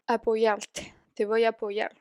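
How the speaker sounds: usual speaking voice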